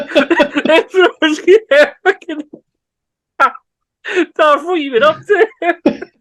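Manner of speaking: high laughing words, slow; cracking up while telling the joke; laughing hard. Real, not acted. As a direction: barely get it out through laughter.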